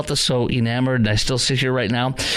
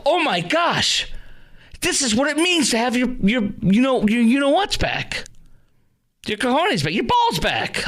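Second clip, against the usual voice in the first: gushing relief; 10 semitones higher than usual. Retold or imitated. Commentator gushing over Trump's strong talk, high and thrilled. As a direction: voice leaps high with giddy thrill. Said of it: "you go".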